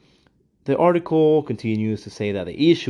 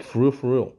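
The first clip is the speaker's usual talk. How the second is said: normal talking voice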